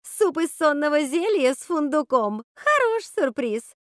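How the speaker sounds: loud, high jeer, slowing at the end; mocking shout. A narrator's reading. Ruby mocks the witch's soup to amuse Martha, mocking shout. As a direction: jeer it out, stretching the last words. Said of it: "крикнула Руби".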